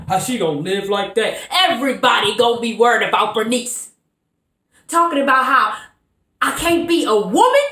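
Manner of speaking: strong-willed woman confronting a man, building then sinking; Bernice monologue. Acted. Bernice challenging Avery about being a woman without a man. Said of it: "playing the character of Bernice".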